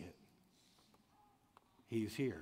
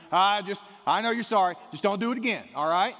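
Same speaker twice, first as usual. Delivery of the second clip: lenient judge in a hammed-up Southern accent, higher and louder; 9 semitones higher, 11 dB louder than usual. Pastor imitating a lenient Austin judge, mock Southern accent. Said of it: "I gotta use a Southern accent for it".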